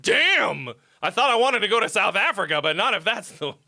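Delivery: playing his own past shock; mock dismay. Retelling reading about car flamethrowers, mock surprise. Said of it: "And I was like".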